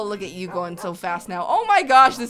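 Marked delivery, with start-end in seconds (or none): 1.5-2.2 loud, thrilled exclamation; amazed outburst. Real, not acted. Player seeing the animals speed up, amazed outburst. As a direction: burst out in delight.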